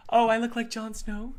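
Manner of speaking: high put-on show-off voice; mock boasting. Streamer mocking a Jon Snow lookalike brag, high put-on voice. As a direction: send up someone pleased with the resemblance. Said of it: "I'm not trying to be a".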